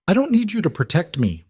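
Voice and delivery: high shout. A narrator's reading. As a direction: shout it back at him. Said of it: "Vivian shouted".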